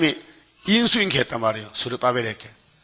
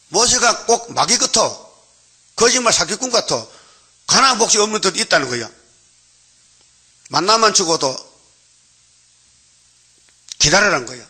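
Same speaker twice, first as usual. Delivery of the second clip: high-pitched accusing gripe, acted out; mock grumbling complaint; 6 semitones higher than usual. Preacher acting out the people turning on Moses, complaining high. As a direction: voice the people griping, pitched well up. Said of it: "모세를 원망하는 거예요".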